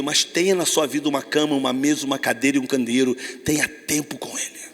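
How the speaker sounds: ordinary talk, nothing marked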